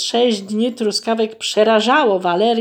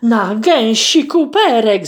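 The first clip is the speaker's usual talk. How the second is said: slow, louder cry of fright; frightened cry; 4 semitones higher, 7 dB louder, slower than usual. Acted. Goose discovers her purse is missing, crying out in alarm. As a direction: exclaim it in sudden alarm. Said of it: "zawołała przerażona".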